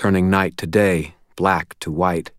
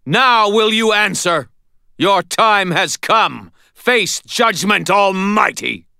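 high, loud possessed declamation; ringing proclamation; 14 semitones higher, 4 dB louder than usual. A narrator's reading. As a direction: thunder judgment like an avenging god. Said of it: "ringing cry".

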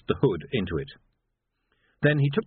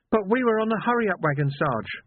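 put-on higher boyish voice, faltering; a shaken lad stumbling over words; trembling young constable; 11 semitones higher than usual. A narrator's reading. Young Sam protesting.

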